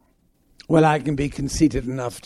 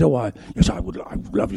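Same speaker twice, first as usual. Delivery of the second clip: low, hushed chin-wobbling impression; imitating Dame Margaret; 6 semitones lower, 5 dB quieter, faster than usual. Actor mimics Dame Margaret accepting coffee, hushed impression. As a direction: mimic her, dropping low and quiet. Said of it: "She had a lot of chins".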